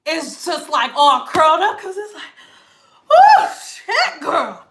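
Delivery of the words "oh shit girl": high, loud shocked squeal; mock-startled hair yelp. Hair personified reacting in shock.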